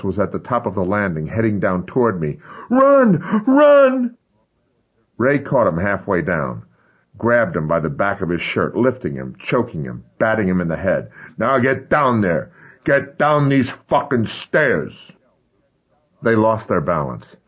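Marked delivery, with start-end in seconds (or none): 2.7-4.2 high panicked shriek; frantic scream. Acted. Mother screaming to boy. 11.4-15.1 slow furious yell. Acted. Ray beating boy on stairs.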